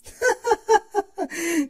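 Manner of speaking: high, helpless burst of laughter. Acted. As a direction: crack up at her sister's mistake. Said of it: "Celestia burst out laughing".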